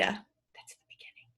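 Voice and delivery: soft whispered hint; whispered aside. Shown as a demonstration. Teacher points out the sound to pupils, whispered aside. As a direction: slip it in under your breath.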